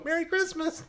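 high, festive falsetto greeting; cartoon revelers. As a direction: pipe a merry greeting from a passing sleigh. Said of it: "revelers and go".

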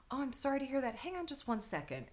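soft, very serious retold sympathy; hushed, straight-faced politeness; deadpan serious. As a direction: quietly imitate a straight-faced receptionist. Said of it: "Very serious".

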